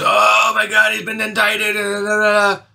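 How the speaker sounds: high-pitched parody of panicked newscasters; mocking high wail, tailing into blah-blah syllables; mock news hysteria. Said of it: "you know, fake news".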